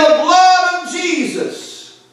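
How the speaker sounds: high, slow, stretched-out street-preacher shout; retold shout. Preacher calling out to the crowd, shouting. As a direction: cry it out like a preacher on the street corner. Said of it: "He was shouting".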